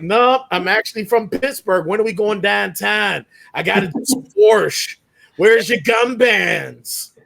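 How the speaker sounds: put-on broad, loud Yinzer accent; Pittsburgh accent. Pittsburgh native answering Chicagoans who hear a Southerner, hammed-up Pittsburgh accent. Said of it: "with the Pittsburghese and the Yinzer dialect".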